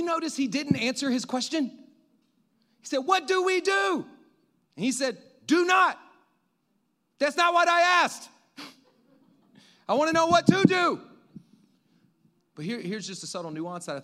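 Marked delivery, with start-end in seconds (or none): 3.0-4.0 high, loud, drawn-out cry; panicked shout. Acted. Re-enacts panicked servant's question. 5.5-6.0 high, loud, forceful order; emphatic shouted command. Acted. Acts master's emphatic answer to servant. 7.2-11.0 high, loud, exasperated protest; frustrated shout. Acted. Acts frustrated servant demanding an answer.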